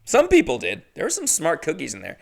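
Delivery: normal talking voice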